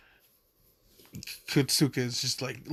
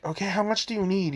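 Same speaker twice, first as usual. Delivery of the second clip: higher, fast quote; 7 semitones higher, faster than usual. Retold or imitated. Momo asks eagerly.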